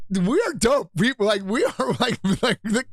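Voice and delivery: gleeful high reenactment of his own excitement; retold giddy joy; excited. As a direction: squeal it like a thrilled kid. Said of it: "I'm like / I remember being so happy about that".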